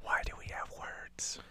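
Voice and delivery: soft unvoiced whisper; breathy whispered aside. Real, not acted. Whispered reply.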